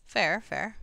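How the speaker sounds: usual speaking voice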